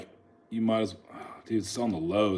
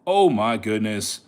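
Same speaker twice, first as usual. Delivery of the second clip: ordinary talk, nothing marked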